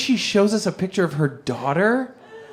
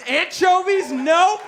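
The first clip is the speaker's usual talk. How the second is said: hammed-up curt refusal; mock dismissive; 8 semitones higher, slower than usual. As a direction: reject her like wrong food. Friend rejecting escort like pizza.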